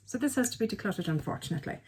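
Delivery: normal talking voice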